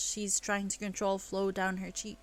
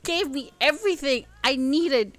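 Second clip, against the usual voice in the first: higher, louder impassioned defence; heated outburst; 6 semitones higher, 9 dB louder than usual. Acted. Elle justifying her anger to James, heated. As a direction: defend her mother fiercely.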